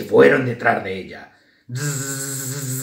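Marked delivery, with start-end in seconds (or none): 1.7-2.8 long imitated bee buzzing. A narrator's reading. Bees set off after flower-covered Grisela, buzzing. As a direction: buzz like a swarm on the move.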